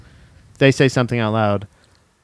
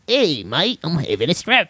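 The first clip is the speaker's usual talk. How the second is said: higher, cheery mock British accent; hammed-up British mate voice; 4 semitones higher than usual. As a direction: make bad news sound jolly in a put-on British accent. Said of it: "British people can make sad things good".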